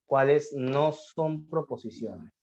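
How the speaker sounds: slowly, word by word, as if writing on the board; slow dictation. Shown as a demonstration. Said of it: "teacher dictating slowly".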